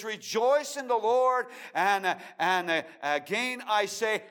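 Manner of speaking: high, loud rising proclamation; ringing preacher's call; preaching at full force. Acted. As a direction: lift the verse up to the rafters.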